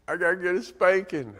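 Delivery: put-on high-pitched little-kid voice; small child answering. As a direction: imitate a young child admitting the consequence to Dad. Small child answers dad's question.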